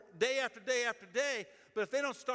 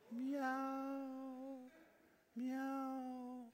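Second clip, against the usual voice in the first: higher, softer mock meow; kitten meow; 6 semitones higher, 7 dB quieter than usual. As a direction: coax the children with a tiny cat sound. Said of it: "I've got a kitten".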